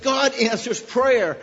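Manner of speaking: slow, loud and high, each word weighed; Jack's firm, loud declaration of faith; emphatic quote. Retold or imitated. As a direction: proclaim it like a testimony. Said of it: "he said".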